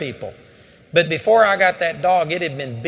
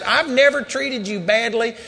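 loud, raised hurt rebuke; angry scolding; 6 semitones higher, 3 dB louder than usual. Retold or imitated. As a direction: shout it, wounded and fed up. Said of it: "reading the riot act of this dog".

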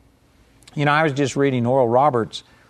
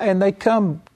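usual speaking voice

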